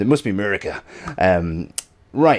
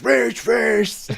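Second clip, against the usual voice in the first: mock rage; 10 semitones higher than usual. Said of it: "And I'm like".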